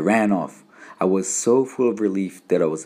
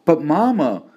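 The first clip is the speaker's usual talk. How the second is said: put-on high, louder plea from a panicked boy; gasped protest; 9 semitones higher, 5 dB louder than usual. A narrator's reading. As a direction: protest to your mother in sudden panic. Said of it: "I gasped".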